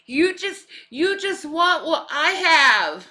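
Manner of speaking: put-on high, loud jealous-taunting mean girl; shrill catty stepsister. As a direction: whine like a vain spoiled diva. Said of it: "That's so Effie".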